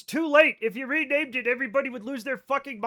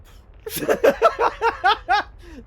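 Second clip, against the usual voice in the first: loud high laugh; 13 semitones higher, 9 dB louder than usual. Real, not acted. Laughing at the pronunciation.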